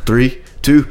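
normal talking voice

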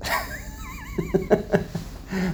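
very high, wheezy burst of laughter; helpless high laughing fit; high-pitched laugh. Real, not acted. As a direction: crack up in a high squeal. Laughs at his own joke.

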